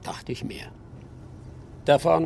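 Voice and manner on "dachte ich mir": whispered dry aside; a knowing whisper to himself. Acted. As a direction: breathe it out, barely voiced. Dry aside after refusal.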